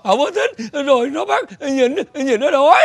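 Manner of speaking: slow, stammering sobs; crying hard. Acted. Father says he was left hungry, crying. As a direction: sob theatrically between the phrases. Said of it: "sao anh khóc dữ vậy".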